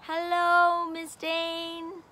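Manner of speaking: ordinary delivery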